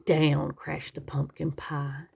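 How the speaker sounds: ordinary delivery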